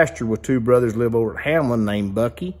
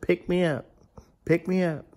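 higher, soft frog voice; small, raised bullfrog voice; talking bullfrog; 4 semitones higher, 7 dB quieter than usual. Acted. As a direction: plead quietly like a little talking frog. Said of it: "there was a bullfrog sitting there".

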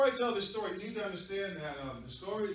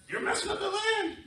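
high, loud scolding cry; dog's shout; 8 dB louder than usual. Acted. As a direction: yell the warning up high. Dog scolding humans.

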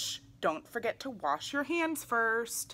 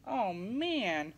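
normal talking voice

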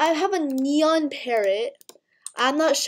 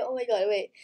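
normal talking voice

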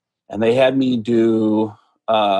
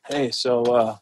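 soft, hesitant invitation; nervous; 5 dB quieter than usual. Retold or imitated. Newcomer asking Seth to hang out, nervous and soft. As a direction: ask timidly, with halting pauses. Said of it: "I'm so nervous".

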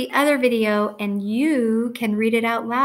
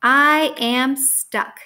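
slow, raised storybook cry of being stuck; a touch of distress, drawn out and higher; mild yelp; 3 semitones higher, 5 dB louder, much slower than usual. A narrator's reading. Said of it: "yelped Norm".

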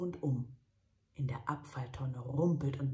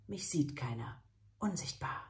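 usual speaking voice

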